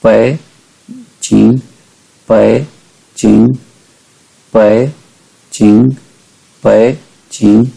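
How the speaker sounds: पै as half third tone, then चिंग; word-by-word drill, half-dipped पै before चिंग. Shown as a demonstration. Teacher drilling third before first tone, पै halved before चिंग. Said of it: "third tone को half pronounce करेंगे".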